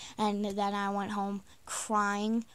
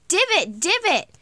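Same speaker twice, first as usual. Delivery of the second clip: high, loud retold warning cry; called-out warning; 8 semitones higher, 6 dB louder than usual. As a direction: call it out as a warning. Calling a warning to the girl.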